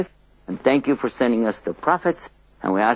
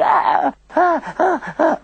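mock overexcited squeals, much higher; too excited; slower than usual. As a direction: squeal it out like a kid who can't contain joy. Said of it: "you get too excited".

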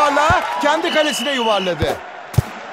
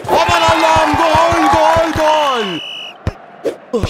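ecstatic goal scream; screaming football commentator; much slower than usual. Acted. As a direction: yell the goal with full joy.

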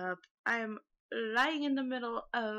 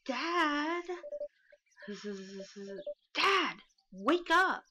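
daughter voice; 5 semitones higher, much slower than usual. Acted. Daughter waking dad, voiced.

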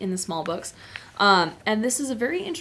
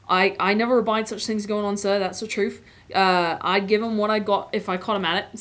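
louder Cockney cabbie voice; Cockney accent; 4 dB louder than usual. Acted. Cabbie telling Aslan he can work, Cockney accent. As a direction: answer respectfully in a working Cockney accent. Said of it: "the Cockney accent is what I've been doing".

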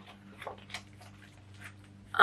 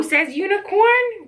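usual speaking voice

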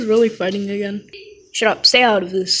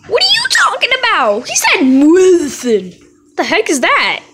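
mock voice; 7 semitones higher, faster than usual. Brother mocking sibling argument.

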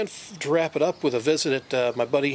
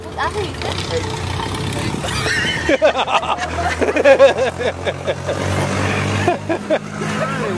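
a long, rolling burst of laughter; long hearty laugh; 8 semitones higher than usual. Real, not acted. Watching the old clip together, a long hearty laugh. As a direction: laugh hard at something silly.

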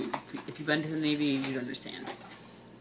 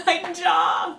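laughing; 10 semitones higher, 7 dB louder than usual. Real, not acted. Laughing after drinking sour water.

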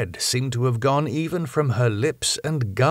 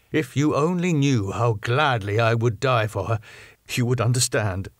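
ordinary delivery

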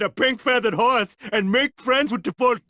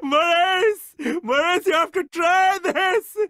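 giddy excitement; 7 semitones higher than usual. Acted. Excited king calling Maurice.

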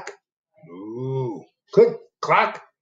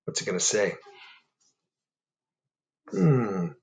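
ordinary delivery, nothing marked

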